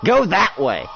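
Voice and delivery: loud, high, exasperated commands; shouted orders. Acted. Soldier redirecting strays from the squad, shouting orders.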